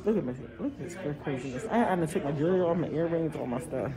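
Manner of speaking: low, very soft update in a public place; hushed, aggravated. Real, not acted. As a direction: keep your voice down while reporting your annoyance. Keeping voice down inside post office.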